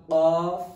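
qaf loud and high, with the qa sound; qaf, not kaf. Shown as a demonstration. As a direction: model QAF distinct from kaf. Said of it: "don't pronounce qaf as kaf".

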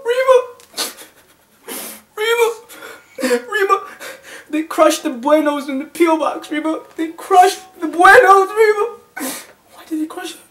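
high, pleading whine; whining complaint.

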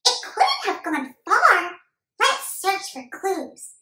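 put-on high, loud kid voice; determined young boy. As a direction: play a boy detective on the hunt. Voices boy Emmett searching.

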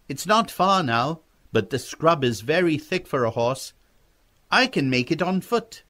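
put-on a boy angling to go on alone; persuading young boy.

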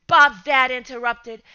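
plain reading voice

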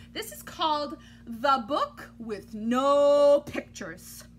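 slow, weighty announcement of the title; slow dramatic title. Acted. Teacher unveiling the book to kids, slow and grand. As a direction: build it up like a grand reveal.

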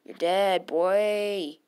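loud trash talk at the opponent; loud taunt. Real, not acted. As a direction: bark it out loud to scare him.

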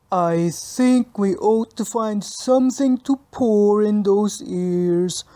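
put-on slow, lisping red-faced boy voice; whisking bully boy. A narrator's reading. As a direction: drag out the threat slowly. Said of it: "said Freddie Filch".

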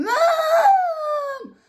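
high crying shout with a laugh in it; screaming for mom. Retold or imitated. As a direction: scream for help mid-panic, half laughing. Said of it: "So I'm screaming".

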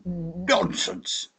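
high, curt scoffing official; dismissive official. A narrator's reading. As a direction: brush it off sharply. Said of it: "said the official from the Admiralty".

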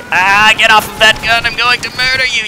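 high, loud mock fury; battle yell. Real, not acted. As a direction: shout him off the gun. Yelling at enemy gunner.

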